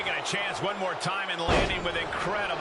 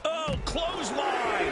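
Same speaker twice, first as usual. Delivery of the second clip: excited call; 8 semitones higher, slower than usual. Acted. Commentator calls a big move.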